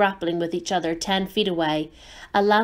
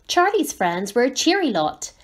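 ordinary delivery

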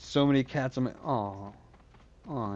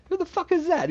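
much higher mock yell; hammed-up angry question; imitated shout. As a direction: fling the question like a road-rage bloke. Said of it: "He's like".

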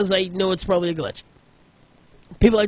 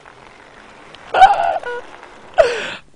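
helpless laughing shriek, much higher; a real high cackle of shock; 8 dB louder than usual. Real, not acted. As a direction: burst into disbelieving laughter. Shocked laughter after explosion.

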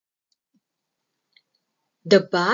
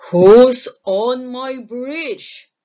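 booming troll; 13 dB louder, slower than usual. A narrator's reading. Troll's first challenge, booming.

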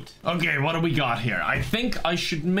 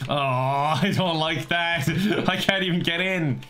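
ordinary talk, nothing marked

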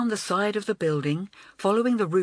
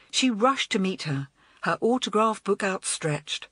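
ordinary delivery, nothing marked

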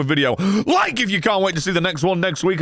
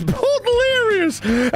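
laughing high squeal; much slower than usual. Real, not acted. Laughing while reading donor names.

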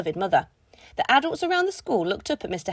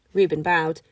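ordinary delivery, nothing marked

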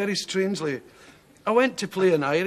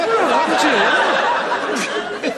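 laughing; 9 semitones higher, 6 dB louder, faster than usual. Retold or imitated. Retorting to Glasgow man, laughing.

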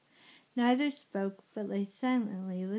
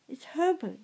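higher-pitched excited cry; mild narrated scream; 7 semitones higher, 4 dB louder than usual. A narrator's reading. Mother realises her son is knocking, mild excited scream. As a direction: read a scream with restraint, pitch up. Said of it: "she screamed".

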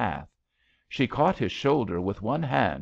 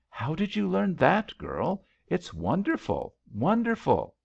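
mild shout in a much higher voice; excited shout; 10 semitones higher than usual. A narrator's reading. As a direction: call out admiringly, pitched high without much volume. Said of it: "he shouted".

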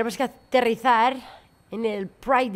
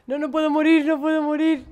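panicked; 6 semitones higher than usual. Real, not acted. About to die in the game.

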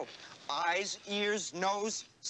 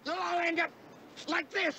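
threatening; 11 semitones higher than usual. Acted. Threatening outburst.

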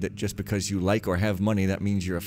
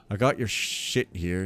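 ordinary delivery, nothing marked

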